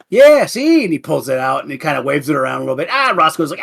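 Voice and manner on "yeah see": much higher, louder show-off; proud kid showing off his gun; boastful kid. Acted. Kid waves revolver boastfully.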